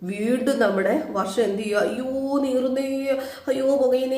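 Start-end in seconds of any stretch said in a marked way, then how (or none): 1.8-4.2 high, very slow hammed-up whine; mock wailing. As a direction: drag out a fake cry of pain. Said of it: "നാട്ടുകാരെ മുഴുവനും അറിയിക്കുകയാണ്". (ഉയർന്ന സ്വരത്തിൽ പതുക്കെ കളിയായി നിലവിളിക്കുന്നു)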